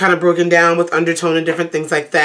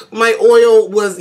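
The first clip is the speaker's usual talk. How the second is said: ordinary talk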